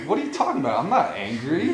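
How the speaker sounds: retold indignant protest, lightly acted; half-serious, offended denial; mock indignation. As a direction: play someone mildly angry while denying it. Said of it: "he started getting angry".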